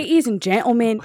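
put-on loud, shaky stab at a Scottish accent; half-hearted Scottish announcer; attempted Scottish accent. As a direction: have a go at Scottish, loudly. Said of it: "it's gotta be in Scottish".

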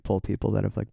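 ordinary delivery

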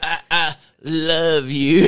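slow, drawn-out mock confession; quoting a movie line. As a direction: stretch it out like the film character, laughing. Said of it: "He said".

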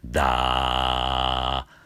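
slow low creaky da; fry on da. Shown as a demonstration. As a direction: rattle into the da. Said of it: "例えばだだったら".

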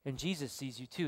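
a soft, low voice in a dark house; very quiet voice. A narrator's reading. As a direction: say it quietly, like someone hidden watching. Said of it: "he hears the voice again".

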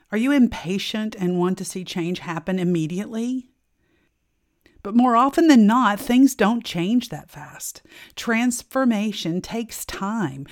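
ordinary talk, nothing marked

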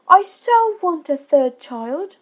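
high, slow motherly voice; lightly acted mother, pitched high and unhurried; reassuring mother. A narrator's reading. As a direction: draw it out to comfort a child. Mother reassures her small son.

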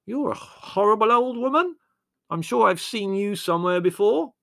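put-on high, loud, bleary drunk voice; hammed-up tipsy accusation to his mother; drunken son. A narrator's reading.

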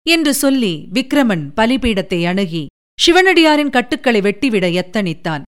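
plain reading voice

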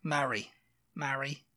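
MARY said as "marry", A as in "hat"; short-A marry. Shown as a demonstration. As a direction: say MARY as "ma-ree", with a short open A. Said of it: "That's how you pronounce M A R R Y".

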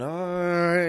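drawn-out, grudging 'all right'; mildly seething concession; through gritted teeth. Acted. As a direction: give in with teeth clenched. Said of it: "his teeth are gritting".

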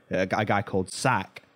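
ordinary delivery

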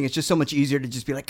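normal talking voice

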